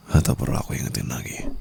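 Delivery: soft threatening whisper; a hushed, sinister threat; menacing whisper. Acted. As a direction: promise it in their ear. Threatening to choke again.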